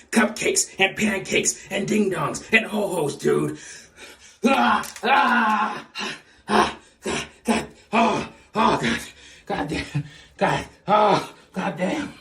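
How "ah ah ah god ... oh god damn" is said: hammed-up pained groans. Mock pain after flexing.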